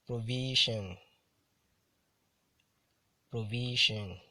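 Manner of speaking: "Provision" with VI hit harder; careful model reading of "Provision", stressed on VI; penultimate stress. Shown as a demonstration. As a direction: lean on VI in "Provision", then fall. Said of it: "stressed on the penultimate syllable".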